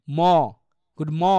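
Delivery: ম as an open "maw", no r. Shown as a demonstration. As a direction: stop ম on the vowel, leaving out the r. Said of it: "তাহলে এটা কি হবে?".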